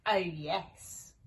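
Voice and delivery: ordinary delivery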